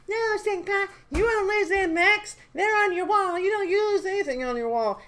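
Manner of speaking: put-on sing-song like a cute anime girl correcting her senpai; Peaches impression. Peaches reassures the streamer about his mechs, high girly voice. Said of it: "And she's like".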